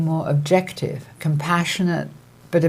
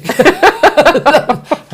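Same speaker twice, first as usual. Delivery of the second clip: high laugh; 11 semitones higher, 8 dB louder than usual. Real, not acted. Laughs at family's joke.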